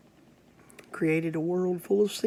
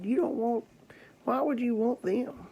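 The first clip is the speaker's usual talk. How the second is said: raised, mocking devil voice, pitched high; scornful devil; 7 semitones higher, 3 dB louder than usual. The devil scoffing at Jesus, high and mocking. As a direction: sneer at the offer like the devil.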